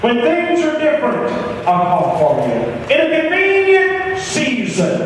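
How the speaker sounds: high, stretched preacher's mimicry; dismissive put-off. Preacher voicing someone putting God off, high and dismissive. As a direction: pitch it high and draw out convenient season.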